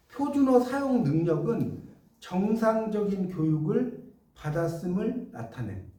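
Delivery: slow, measured dictation to the class. Shown as a demonstration. Teacher slowly dictating a definition for students to copy. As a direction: read it out slowly for notes. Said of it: "써보세요".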